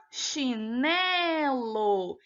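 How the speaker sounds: "chinelo" very slowly, with NE held long; stress on NE. Shown as a demonstration. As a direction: stretch the middle syllable NE in "chinelo". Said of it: "A penúltima sílaba é a sílaba tônica".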